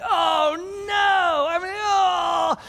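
high, loud exasperated "oh no"; retold frustrated yell. Dad overreacting to spilled coffee, loud yell. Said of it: "I'm like".

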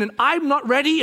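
ordinary talk

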